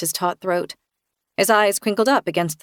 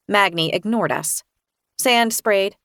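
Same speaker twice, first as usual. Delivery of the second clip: ordinary delivery, nothing marked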